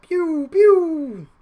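high mock laser pew pew; mock gun noise. Gamer mimicking another player's missiles, high mock pew pew. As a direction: imitate shots playfully.

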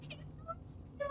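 high, strained laughter breaking through the words; hysterical laugh. Real, not acted. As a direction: laugh in disbelief at the disaster. Creature gone, hysterical.

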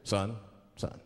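deep, deliberate traffic-stop officer; police officer. Acted. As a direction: drop the pitch and question like a patrolman. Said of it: "the police pull me over".

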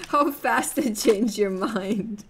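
loud, drawn-out words through laughter; slow, loud teasing through real laughs; laughing. Real, not acted. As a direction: crack up while saying it. Laughing at chat's reversal.

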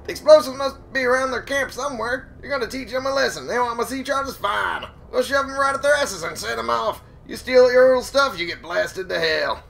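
high-pitched crazy hermit complaining about bandits; crazy old hermit voice. Retold or imitated. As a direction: rant like an old man whose stuff got stolen. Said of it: "I don't know what the hell that voice was".

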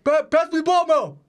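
high-pitched mock calling out; excited fan. Acts fans calling to Mo.

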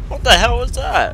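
high loud shaken question; shocked outburst. Real, not acted. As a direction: blurt it out after the scare. After jump scare.

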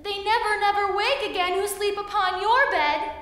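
high, loud little fly voice; buzzy housefly. Acted. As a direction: squeak it high and urgent. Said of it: "said the little fly".

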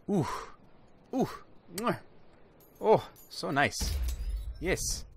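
normal talking voice

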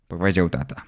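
ordinary delivery, nothing marked

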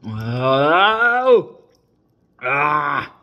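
loud strained oh then ah; pained exclamation. Real, not acted. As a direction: react to the sour with a pained cry. Another sip of sour beer.

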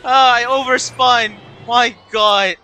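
frustrated shout. Real, not acted. Racing game mistake.

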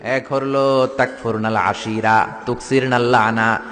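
slow, low recitation of the Arabic phrase; slow measured recitation; hadith recitation. Acted. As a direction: intone it slowly like a quoted hadith.

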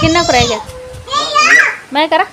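loud call across the yard. Real, not acted. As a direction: shout the question to someone far away. Calling out to someone far off.

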